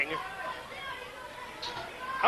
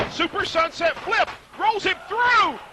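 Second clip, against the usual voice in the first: excited call; 8 semitones higher than usual. Acted. Building near-fall call.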